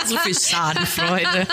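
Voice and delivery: real giggling remark; laughing. Real, not acted. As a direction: gasp it out laughing. Laughing at the slip.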